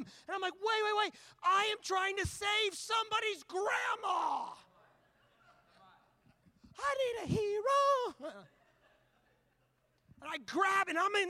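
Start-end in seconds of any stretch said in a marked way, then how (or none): 0.5-8.4 high, drawn-out mock-heroic shout; frantic shouted plea. Preacher acting himself saving a grandma, frantic high shout. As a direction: cry out desperately, stretching the last words. Retold frantic outburst to onlookers.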